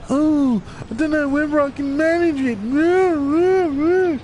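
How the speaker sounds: high, slow whiny mock voice; mock a whiny, self-doubting hero; whingy Superman. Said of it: "this fucking whingy, bitchy".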